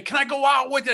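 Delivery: a hint of a begging child; pleading little brother. Little brother begs to tag along.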